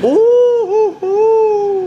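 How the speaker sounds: high loud squealing ooh; excited squeal. Real, not acted. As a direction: squeal with glee. Excited reaction to the food.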